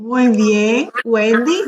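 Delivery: usual speaking voice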